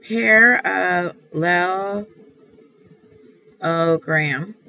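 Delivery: drawn out very slowly with gaps between syllables. Shown as a demonstration. Teacher writing the word out for class, very slow syllable by syllable. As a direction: break "parallelogram" into slow, separate syllables.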